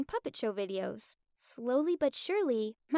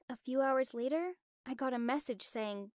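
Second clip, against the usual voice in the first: usual speaking voice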